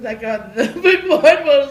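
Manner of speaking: loud laughing through the words. Real, not acted. Telling about the kids leaving school, loud laughter. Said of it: "laugh heard".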